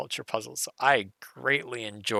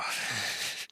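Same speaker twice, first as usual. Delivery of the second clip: laugh; 5 dB quieter than usual. Real, not acted. Relieved laugh after solving.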